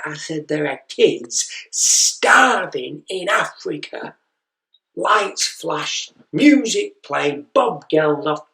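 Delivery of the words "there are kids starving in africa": slow, weighty parental pronouncement; stern parent cliché. Retold or imitated. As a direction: deliver it like a solemn parental sermon. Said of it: "the phrase that every parent says".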